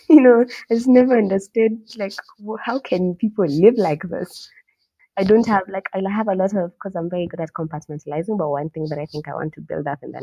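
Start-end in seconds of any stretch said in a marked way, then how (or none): 0.1-6.5 laughing disbelief. Real, not acted.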